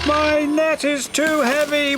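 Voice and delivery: a lightly hammed-up wail. A narrator's reading. Harold sinking under the heavy net, wailing. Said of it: "wailed Harold".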